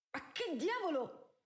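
high, slow startled outburst; startled exclamation. Acted. Araminta hears a commotion down the corridor, startled and high. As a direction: caught off guard by the noise. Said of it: "esclamò".

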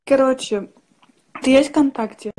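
usual speaking voice

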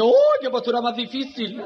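high squeal of mock dismay. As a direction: exclaim it with effeminate theatrics. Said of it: "Dice un mariquita al otro".

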